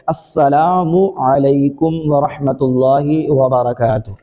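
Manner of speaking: السلام علیکم ورحمۃ اللہ وبرکاتہ spelled out very slowly, each word fully articulated; careful correct greeting. Shown as a demonstration. Preacher teaching his listeners the correct greeting: slow, exact السلام علیکم ورحمۃ اللہ وبرکاتہ. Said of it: "جو صحیح طریقہ ہے سلام کرنے کا".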